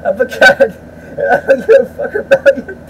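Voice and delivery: high chant breaking into laughter; cracking up. Real, not acted. Vlogger losing it over his cat chant, laughing. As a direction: try to keep chanting while laughing.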